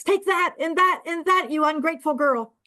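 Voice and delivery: high, furious innkeeper shouting; irate innkeeper. Acted. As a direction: beat her while yelling. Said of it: "the irate innkeeper punishing the corpse".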